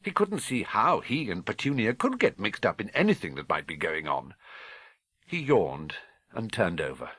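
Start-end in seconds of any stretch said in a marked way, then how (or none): none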